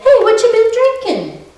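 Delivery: put-on high, cheerful old man's call; an old man warmly calling a stranger over; friendly old man. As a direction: beckon him over, light and inviting. Old man greeting the drunk.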